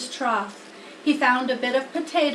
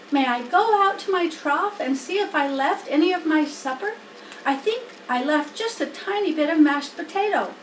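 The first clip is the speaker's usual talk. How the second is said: higher, anxious little pig voice; a worried piglet begging for hope; young pig Wilbur; 5 semitones higher, 4 dB louder than usual. Acted. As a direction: Wilbur asks the spider to save him.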